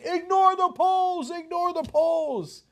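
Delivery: high, slow mocking shout; hammed-up TV pundit brushing off the polls; drawn-out sarcastic chant-like yell. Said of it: "everybody today on T V is yelling".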